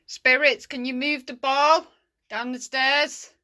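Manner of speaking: loud, high call, slowing at the end; shouting. Real, not acted. Ghost hunter asking spirits to move a ball, shouting. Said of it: "Don't mean to shout".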